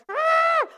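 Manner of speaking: put-on high, loud toddler shriek of rage; imitating a four-year-old's angry yell; angry kid yell. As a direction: let out a furious little-kid scream. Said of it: "and he goes".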